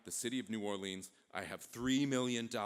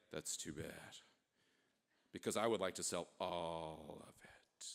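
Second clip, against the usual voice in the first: low, hushed and deliberate; Napoleon, ominous; 8 semitones lower, 5 dB quieter than usual. Acted. Napoleon offering the envoy all of it, low and ominous. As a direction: dangle the offer in a low, quiet voice.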